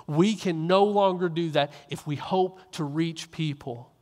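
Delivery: ordinary talk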